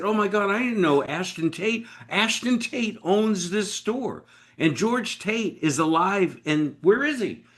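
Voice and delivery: high, thrilled rush; excited. Retold or imitated. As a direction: gasp it out like you found gold. Retelling his excitement.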